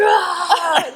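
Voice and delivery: high, loud cry of anguish; retold anguished yell. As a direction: let out a pained yell. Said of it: "And then I'd be like".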